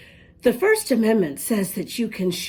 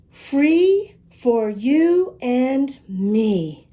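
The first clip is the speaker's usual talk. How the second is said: slow, louder, drawn-out title; title read slowly; 4 semitones higher, 4 dB louder, much slower than usual. Real, not acted. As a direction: announce the title grandly and slowly. Reading book title aloud.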